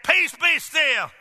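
slow ringing command to the storm; commanding calm. Acted. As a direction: speak it like you still the sea.

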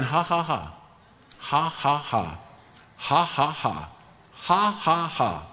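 measured ha-ha-has, each set dropping at the end; deliberate mock laugh. Retelling a sick man answering symptoms, deliberate mock laugh. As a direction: spell out the laugh in steady, separated beats. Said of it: "he just started saying".

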